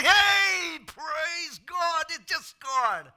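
loud, high cheer as a goal goes in; excited fan cheer. Retold or imitated. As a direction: jump up and yell it like a fan. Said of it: "I'll be jumping up and say".

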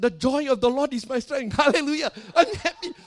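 high, loud hammed-up rejoicing; mock-triumphant praise shout; exultant retort. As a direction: proclaim it jubilantly, voice soaring. Acted joyful retort to the accusation.

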